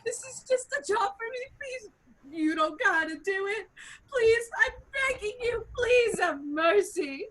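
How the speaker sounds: tearful begging. Acted. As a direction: beg desperately through hammed-up tears. Acted pleading for his life.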